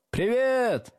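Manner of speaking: high, drawn-out squeal; squealed greeting. A narrator's reading. Pupils at back desks greet new teacher, squealing. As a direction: squeal it long from the back row. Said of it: "запищали с задних парт".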